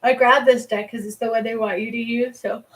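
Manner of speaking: ordinary talk, nothing marked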